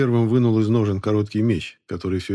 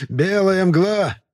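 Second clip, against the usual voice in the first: shrill, piping voice, much higher; high squeaky voice; 10 semitones higher, 5 dB louder, much slower than usual. Acted. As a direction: squeak it out like a eunuch. Sermet's voice again.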